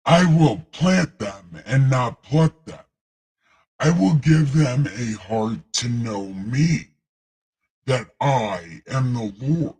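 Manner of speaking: God voice. Acted. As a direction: boom out like the Almighty, slow and grave. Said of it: "I gotta do it with God voice".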